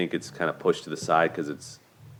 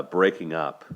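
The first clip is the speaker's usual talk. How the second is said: usual speaking voice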